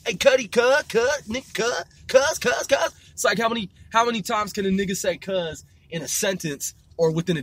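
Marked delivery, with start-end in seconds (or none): 0.0-2.9 higher-pitched mock gang hailing; exaggerated Crip call-outs, pitched up; imitating Crip talk. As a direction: fire off repeated slang greetings as a parody. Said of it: "They talk like this".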